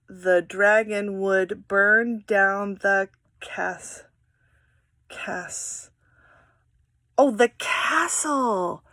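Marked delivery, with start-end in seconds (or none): 3.4-5.9 whispered syllable. Shown as a demonstration. Struggling to sound out word.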